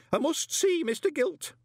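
very high, loud demand; urgent, high-pitched flustered man; panicky visitor. A narrator's reading. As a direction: insist frantically on seeing the master.

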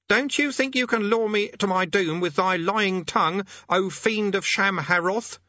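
high-pitched, declamatory incantation; self-important conjurer. Acted. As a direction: command a demon in a high voice. Conjurer defies the demon.

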